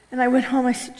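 usual speaking voice

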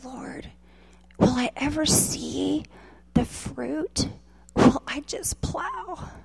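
soft whispered plea, almost no voice; tearful whisper. Retold or imitated. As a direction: breathe the question out through tears. Tearful prayer retold.

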